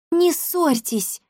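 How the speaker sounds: put-on high, slow girl's admonishment; frowning, drawn-out plea in a high voice; disapproving frown. A narrator's reading. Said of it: "нахмурилась Дарина".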